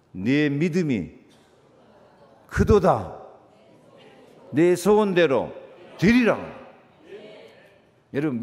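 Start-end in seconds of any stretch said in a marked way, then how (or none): 0.1-6.6 voicing Jesus. Jesus's words quoted in a sermon.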